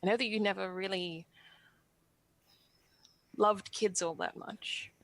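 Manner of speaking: halting, on the verge of tears; hesitant confession with long, emotional pauses; tearful, holding back. Acted. Asking for a blessing to have a family, tearful. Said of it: "I was really tearing up".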